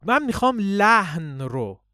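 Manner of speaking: ordinary talk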